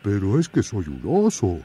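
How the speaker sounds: oso firme y sencillo; bear folk. Acted. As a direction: explica con calma que es oso. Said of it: "dijo el oso". (oso que protesta)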